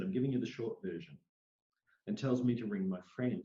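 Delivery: normal talking voice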